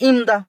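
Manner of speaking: ইমদা as joined im-da; pronunciation demo. Shown as a demonstration. Teacher answering how it is pronounced, demonstrating ইমদা as im-da. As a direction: say ইমদা with the m running into da. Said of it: "কি উচ্চারণ হবে?".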